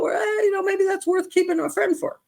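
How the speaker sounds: an imagined friend justifying the friendship, in a high mock voice; mock self-talk. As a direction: play the excuse-maker talking herself into it. Voicing listener's inner rationalising.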